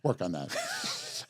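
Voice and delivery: low, slow mock-stern dad voice; imitating annoyed father. Host quoting his father who got mad, mock-stern. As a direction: mutter it softly like a fed-up dad. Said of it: "my father used to say every time he got mad".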